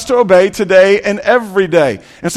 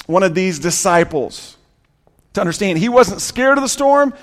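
ordinary talk, nothing marked

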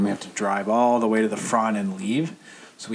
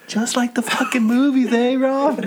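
high, taunting mock yell; retold yelling; 13 semitones higher, 4 dB louder than usual. Yelling at fallen friend from the truck, high mock yell. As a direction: holler it mockingly, pitched way up. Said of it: "I start yelling, like".

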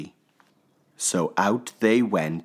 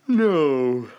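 sleepy yawning no; yawned no; 8 semitones higher, 5 dB louder than usual. Acted. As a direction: yawn the word out. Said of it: "he yawned".

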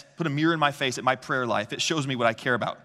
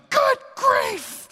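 high, louder cartoon-kid exclaim; Charlie Brown exclamation; 6 dB louder than usual. Oldest son reacting to brother with knife, high cartoon exclamation. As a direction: cry it out like Charlie Brown, much higher. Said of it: "who they call Charlie Brown".

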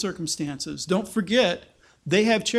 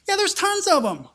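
sarcastic; 11 semitones higher than usual. Pretend. Answering own sarcastic question.

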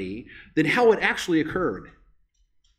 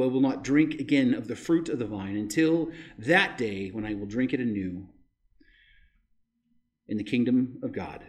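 low, measured Bible reading; scripture reading; 6 semitones lower than usual. Acted. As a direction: read the Gospel with solemn weight. Reads scripture aloud at the service.

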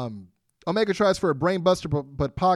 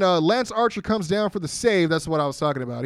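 normal talking voice

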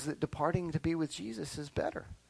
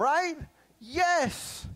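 put-on relieved child; 11 semitones higher, 4 dB louder than usual. Child voice continues.

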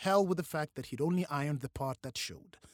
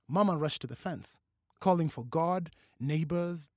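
ordinary delivery

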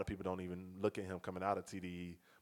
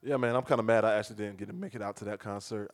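usual speaking voice

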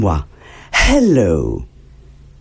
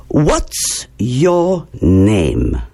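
What's your name, very slow, each word stretched; slow model question; 3 semitones lower, much slower than usual. Shown as a demonstration. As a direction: model it slowly for children. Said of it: "Repeat after me". (très lent et bien articulé)

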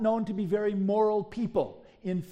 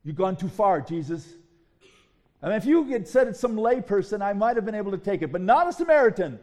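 louder, fast indignant outburst at Jesus; fuming lawyer; 7 dB louder, faster than usual. Retold or imitated. The lawyer protests to Jesus, louder and fast. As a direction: protest hotly and quickly, voice rising. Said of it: "The lawyer is fuming".

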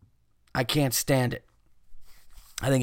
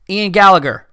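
announcer voice; 6 semitones higher, 9 dB louder than usual. Acted. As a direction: announce the name like a host. Announcing the submitter's name.

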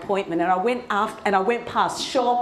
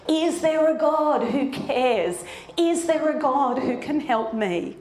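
searching, wondering plea; 7 semitones higher than usual. Acted. Voicing people struggling with life, a wondering plea. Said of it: "struggling with life and wondering".

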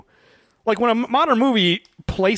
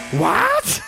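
high, stretched mock disbelief; a much higher, hammed-up what; incredulous what. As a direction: imitate a stunned, rising what. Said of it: "then she goes".

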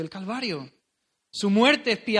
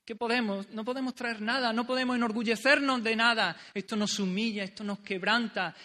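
usual speaking voice

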